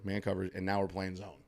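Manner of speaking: normal talking voice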